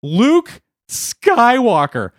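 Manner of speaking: very high, laughing through the name; giddy high-pitched laughing reveal; cackling announcement. Acted. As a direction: crack up at your own joke. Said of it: "He says".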